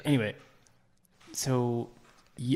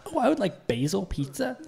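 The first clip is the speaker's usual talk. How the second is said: mock customer voice; 9 semitones higher than usual. Mocking a customer's order.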